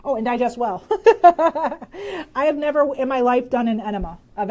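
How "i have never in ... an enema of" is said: laughing. Real, not acted. Laughing at enema question.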